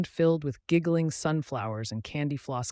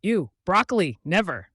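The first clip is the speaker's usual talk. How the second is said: louder, lightly disgusted refusal; 4 semitones higher, 6 dB louder than usual. A narrator's reading. Boy refuses monster's broccoli, mildly disgusted. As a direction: recoil in revulsion, higher and loud.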